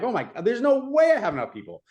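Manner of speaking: high-pitched mock dismay; high, incredulous retelling; retold panic. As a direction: replay a panicked thought, voice jumping up. Retelling her panicked thought during vote.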